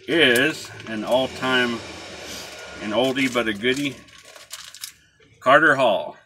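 talking around pipe stem; words through clenched teeth; pipe in mouth. Real, not acted. Seen 4: pipe stem clenched in teeth while speaking all labelled words.

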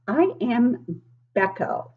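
usual speaking voice

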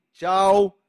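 loud goodbye called out; acted farewell. Host acting out leaving the family, loud acted farewell. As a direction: call out a mock send-off.

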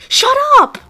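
high, loud snapping shout; angry shout. Real, not acted. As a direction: yell it back furiously. Yells back at the devil voice.

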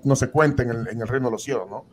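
ordinary talk, nothing marked

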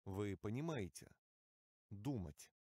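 normal talking voice

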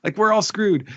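high-pitched comic panic; mock dismay. Imitating readers hit by the twist, mock panic. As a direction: wail the bad news, pitch way up. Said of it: "it's just like".

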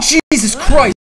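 high shriek of real fright, loud; jolted by a sudden scare, cry it out; startled scream. Real, not acted. Player caught by a jump scare, startled scream.

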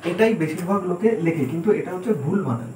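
normal talking voice

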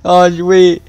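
high, loud delighted squeal; excited surprise. Real, not acted. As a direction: shout it out in happy disbelief. Excited surprise at stream joiner.

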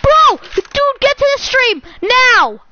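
loud, high-pitched urgent holler; excited shout. Real, not acted. Gamer summoning a friend to the stream, excited shouting. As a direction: call them over right now, loud and high. Calling someone to see the stream.

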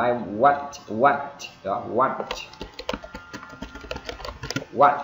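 "want" with a rising tone and separate T pop; emphatic "want", crisp T burst; clear final T. Shown as a demonstration. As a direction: stop briefly, then release the T of "want". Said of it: "Âm T này đó".

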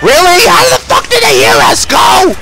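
high, loud disbelieving yell; outraged shout. Real, not acted. Gamer furious the monsters heard them anyway, loud shouting. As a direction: explode in disbelief. Enemies hear them, outburst.

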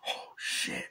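soft whisper under the breath; whispered reaction. Retold or imitated. Retelling cab driver looking at her number, stunned whisper.

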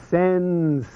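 Italian accent. Shown as a demonstration. Speaker presenting a principle, showy Italian accent. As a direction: stretch it syllable by syllable in an Italian accent. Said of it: "with your best Italian accent".

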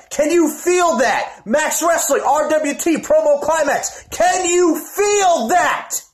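high, slow, building wrestling-promo yell; promo shout. Acted. Wrestler hyping the crowd at the promo climax, shouting. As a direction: blow the roof off the arena.

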